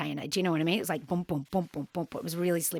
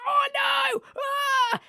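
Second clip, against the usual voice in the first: shout of pain. Retold or imitated. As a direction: yelp when it suddenly registers. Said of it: "I was suddenly like".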